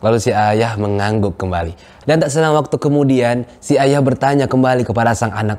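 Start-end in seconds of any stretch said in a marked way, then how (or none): none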